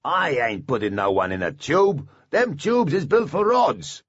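stubborn dwarf. A narrator's reading. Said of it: "shook his head stubbornly".

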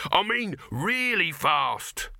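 high, slow, emphatic insistence; exasperated, high-pitched emphasis; insistent shout. A narrator's reading. Dibbler insisting amid shouted argument.

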